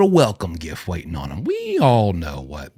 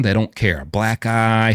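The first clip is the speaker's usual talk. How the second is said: ordinary talk, nothing marked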